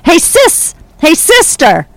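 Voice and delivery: a man calling his cat at the wharf. Retold or imitated. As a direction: call her over fondly. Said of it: "You called her sis".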